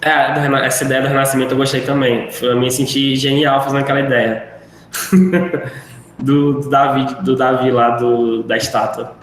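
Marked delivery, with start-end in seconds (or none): none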